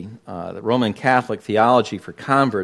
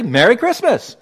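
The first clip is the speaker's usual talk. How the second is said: Merry Christmas raised high and loud; say it boldly; 11 semitones higher, 5 dB louder than usual. Shown as a demonstration. Preacher urging the church to say Merry Christmas out loud. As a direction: proclaim it with full voice.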